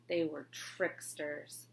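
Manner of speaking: usual speaking voice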